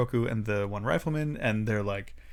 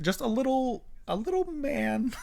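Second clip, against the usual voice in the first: high cutesy voice, softer; 11 semitones higher, 4 dB quieter than usual. Acted. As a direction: coo it like it's adorable. Cutesy description of creature.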